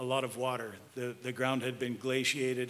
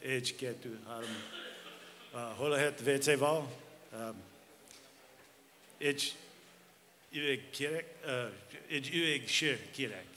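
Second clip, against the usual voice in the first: American accent Hungarian. Real, not acted. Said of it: "I'll get all my Hungarian words out of the way".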